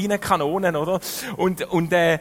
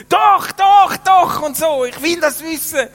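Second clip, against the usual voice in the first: high, loud, excited yell; insist, shouting over the music; eager English clubber pressing for an answer; insistent shout; 10 semitones higher, 7 dB louder, slower than usual. Retold or imitated.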